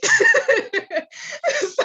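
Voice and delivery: high, loud burst of laughter; real high laugh; big laugh. Real, not acted. Laughs at own story.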